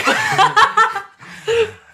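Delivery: loud, high burst of laughter; real laughter. Real, not acted. Player cracks up after admitting he felt awful, real laughter. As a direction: crack up helplessly.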